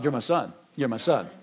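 soft, low, tender reassurance; a father gently hushing his returning son. Retold or imitated. Father embracing his repentant son, soft and tender.

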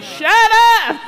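high, loud mock snap of the banker; mock rebuke. Banker snaps at the begging heir, high and loud. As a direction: bark it out as the exasperated banker. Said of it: "he's like".

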